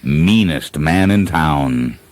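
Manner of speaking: usual speaking voice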